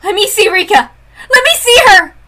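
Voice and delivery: high loud crying demand; crying plea. Acted. Girl begging the villain to see her friend, crying plea. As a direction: plead through tears.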